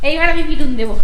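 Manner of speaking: hammed-up parent threat; mock scolding. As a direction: pretend to be cross, half-laughing.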